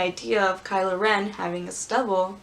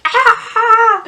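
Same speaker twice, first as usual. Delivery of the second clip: high, loud comic scream; exaggerated high-pitched yell of pain; mock scream; 13 semitones higher, 11 dB louder than usual. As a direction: scream as if zapped, played for laughs. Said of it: "who screams".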